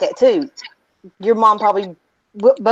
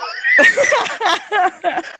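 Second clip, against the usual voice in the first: high, falling laughter; real helpless giggling; laughing fit; 7 semitones higher, 4 dB quieter than usual. Real, not acted. Laughing with friends at the hairball story, high real laughter.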